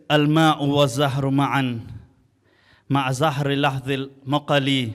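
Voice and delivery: poem recitation. Acted.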